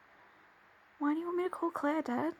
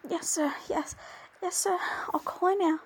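normal talking voice

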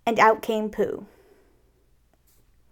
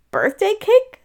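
much higher, louder rising question; puzzled surprise; 11 semitones higher, 6 dB louder than usual. Acted. Pooh finding no birthday cake, puzzled. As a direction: squeak it out in disbelief.

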